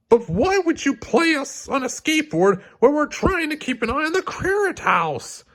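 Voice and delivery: very high, whining exasperated question; exasperated Bob. Acted. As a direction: plead in disbelief.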